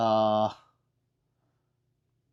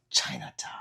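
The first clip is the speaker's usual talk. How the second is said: whisper; 13 semitones lower, 7 dB quieter than usual. Real, not acted. Whispered aside answering chat.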